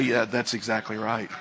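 low, soft, hinting at a sly Satan; Satan's voice. Retold or imitated. Satan taunting a fearful believer, softly low. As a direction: murmur it like a tempter. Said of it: "And Satan will say".

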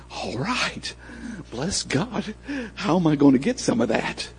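high, hushed and eager; soft, hungry excitement; eager greed. Retold or imitated. Tempted man greedily agrees.

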